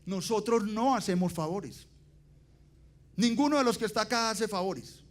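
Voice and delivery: firm, emphatic rebuke, pitch and volume climbing; exaggerated stern telling-off; forceful refusal. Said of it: "no se lo dije así de fuerte".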